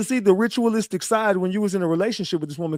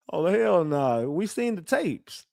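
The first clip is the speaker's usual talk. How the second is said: ordinary talk, nothing marked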